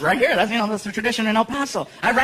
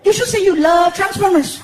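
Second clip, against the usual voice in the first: put-on much higher, loud child voice; little boy; 9 semitones higher, 4 dB louder than usual. Son Frankie walking into the kitchen, high kid voice. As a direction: play a sleepy excited kid.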